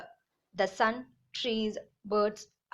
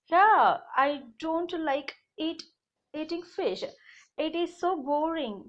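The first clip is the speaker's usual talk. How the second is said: chicken voice; 5 semitones higher than usual. Acted. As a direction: play a fussy little chicken. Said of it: "The chicken said".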